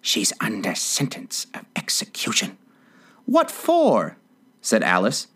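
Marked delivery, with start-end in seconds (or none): 0.0-2.6 soft, voiceless confidential whisper; hushed, secretive whisper. A narrator's reading. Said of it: "and whispered". 3.2-4.2 very high, sharp query; high-pitched puzzled demand; startled question. A narrator's reading. Alice questions the Rabbit.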